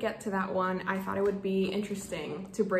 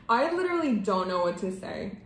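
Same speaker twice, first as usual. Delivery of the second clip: usual speaking voice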